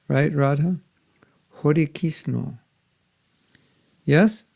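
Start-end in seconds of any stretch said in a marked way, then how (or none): 1.6-2.6 Hare said with a soft D-like R, then Kisno ending in O; a Bengali-style Hare Kisno. Shown as a demonstration. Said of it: "If you go to Bengal".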